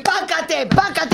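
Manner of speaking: mock blows. Doctor warning of consequences, high mock blows. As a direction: fire off each word as a hit. Doctor mimes hitting the patient.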